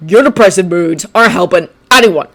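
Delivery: a scornful put-down; scoffing. Acted. Bakugo scoffing at Shinso's moods. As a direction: sneer it at him. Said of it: "scoffed Bakugo".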